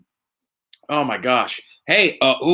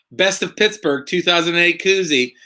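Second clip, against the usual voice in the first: ordinary talk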